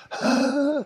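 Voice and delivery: short sharp huff instead of a sigh; tense huff. Shown as a demonstration. Teacher showing a stressed client's huff. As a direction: push out a tight, clipped huff. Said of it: "they go, it's a huff".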